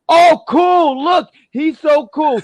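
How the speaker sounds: loud, very high fake gushing; hammed-up fawning shout; mock admiration. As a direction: gush like an over-impressed fan, drawn out. Mocks fans admiring Peanut Butter.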